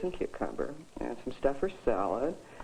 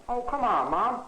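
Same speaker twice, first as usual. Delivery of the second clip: louder pleading protest, stress on come; louder whiny plea; 7 semitones higher, 5 dB louder than usual. Acted. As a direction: push the plea harder. Son pleads again louder.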